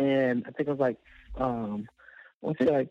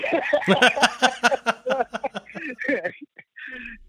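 a real burst of laughter; laughing; 9 semitones higher, 3 dB louder than usual. Real, not acted. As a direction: laugh at your own memory. Laughs at own story.

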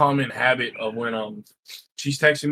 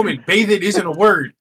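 loud, raised-pitch comic exasperation; higher, louder retold protest; mock heated argument; 6 semitones higher, 6 dB louder than usual. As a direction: argue the point with playful indignation. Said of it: "this heated argument".

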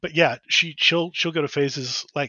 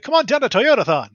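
loud, higher hammed-up announcer pitch; car commercial voice; 8 semitones higher, 5 dB louder than usual. Shown as a demonstration. Host mocks his own flat voice, booming car commercial voice. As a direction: sell it like a car ad. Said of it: "sounds like a car commercial".

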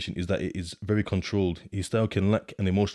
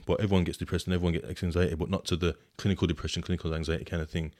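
usual speaking voice

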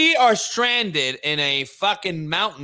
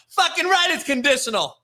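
ordinary talk

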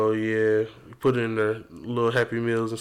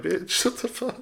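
annoyed moviegoer; 7 semitones higher than usual. Retold or imitated. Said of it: "They'll look at you like".

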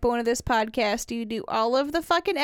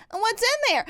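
put-on high, wondering child voice; mimicking a curious toddler; 9 semitones higher than usual. Mom imitating her son asking what's in there. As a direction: play the kid poking at the scale. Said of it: "Okay, Porter".

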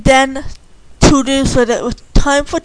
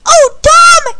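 high, loud indignant cry; indignant outburst; 7 dB louder than usual. Acted. Candy to Tom as their fight starts, indignant outburst. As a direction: explode at a rival, loud and high.

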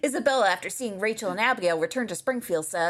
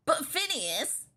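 high, drawn-out whine; whiny; 6 semitones higher, 4 dB quieter, slower than usual. Acted. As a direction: whine it slowly like a hesitant cartoon boy. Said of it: "says in a whiny voice".

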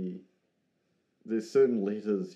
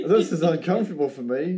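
laughing; 7 semitones higher, 7 dB louder than usual. Real, not acted. Laughing at awkward Kiwi moment.

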